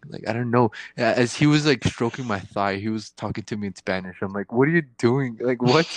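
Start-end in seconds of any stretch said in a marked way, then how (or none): 4.5-5.9 high, loud startled protest; shocked. Retold or imitated. Patient startled by the doctor, higher and louder. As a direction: blurt it out, caught off guard.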